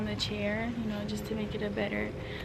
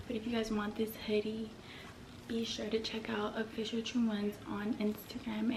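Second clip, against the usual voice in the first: whispering; 9 dB quieter than usual. Real, not acted. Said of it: "don't mind me whispering".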